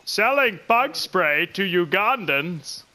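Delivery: slow evil cartoon villain; Plankton voice. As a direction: scheme like a tiny villain. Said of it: "doing that creepy looking plankton".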